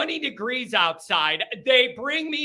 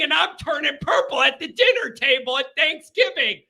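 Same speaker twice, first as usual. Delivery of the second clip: normal talking voice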